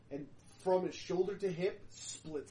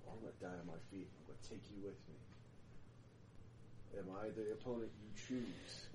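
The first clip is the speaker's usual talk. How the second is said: much lower, quiet, grim vow; low defiant growl; 7 semitones lower, 13 dB quieter, much faster than usual. Acted. Condemned vampire vowing to the judges, low and grim.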